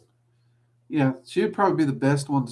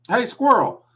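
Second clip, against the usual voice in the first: raised voice, calling a name; 10 semitones higher, 6 dB louder than usual. Real, not acted. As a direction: call out to someone in another room. Calling out across the house.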